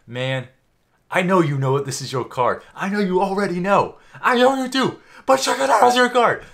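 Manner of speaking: high, loud cocky showman; cocky performer voice. Retold or imitated. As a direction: taunt like a swaggering showman. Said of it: "He's like".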